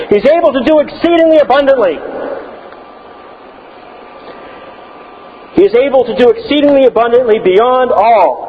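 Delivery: high, fervent pulpit shout; imitating a Scottish preacher's shout. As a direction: build it up like an old preacher. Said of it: "he would shout that out".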